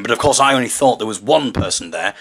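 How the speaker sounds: usual speaking voice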